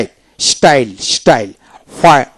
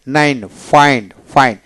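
ordinary talk, nothing marked

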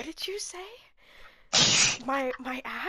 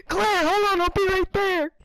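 loud heroic call; Leon voice line; 5 semitones higher, 7 dB louder than usual. Acted. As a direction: call out like a game hero. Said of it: "Leon's lines".

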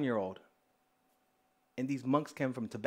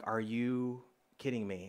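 low, slow, softer incredulity; deadpan disbelief; 4 semitones lower, 7 dB quieter, slower than usual. Retold or imitated. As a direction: retell it as stunned, hushed disbelief. Retold stunned reaction to prophecy.

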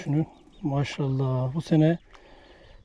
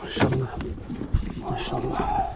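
whispered praise; 5 dB quieter than usual. Real, not acted. Admiring the fish softly.

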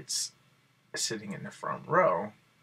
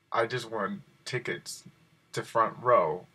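ordinary delivery

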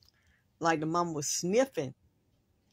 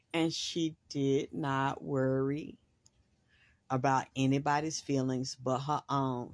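normal talking voice

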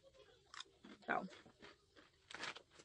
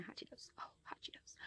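whisper; 17 dB quieter, faster than usual. Real, not acted. Savoring snack, whispered.

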